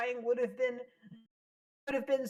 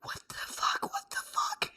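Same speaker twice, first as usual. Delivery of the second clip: whisper; 4 dB quieter than usual. Real, not acted. Stunned by character's death.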